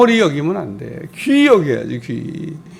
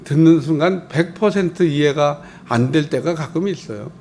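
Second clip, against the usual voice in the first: normal talking voice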